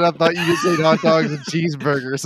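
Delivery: high, loud quote through laughter; laughing retelling. Retold or imitated. Friend repeating the Spanish woman's line, laughing. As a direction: retell the punchline while cracking up.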